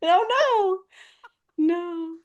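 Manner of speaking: embarrassed protest. Real, not acted. Embarrassed protest after teasing.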